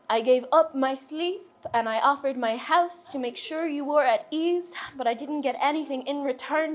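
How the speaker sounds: rhythmic spoken-word delivery, lifted above chat; poem recitation. Acted. Poet reading "The Odd Sock" to an audience, spoken-word recital. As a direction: recite it as a heartfelt confessional poem.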